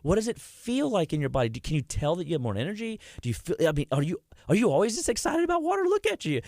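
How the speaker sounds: shower her with excitement; husband showing how to celebrate his wife's win; gushing praise. Retold or imitated. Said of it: "I should have said".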